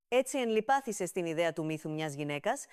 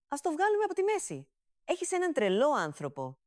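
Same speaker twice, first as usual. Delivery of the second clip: excited; 8 semitones higher than usual. Acted. Mother fussing over sick son.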